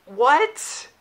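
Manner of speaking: a high, rising, incredulous what; disbelief. Real, not acted. Reviewer reacting to the movie's plot twist, incredulous. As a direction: sound truly baffled by the scene.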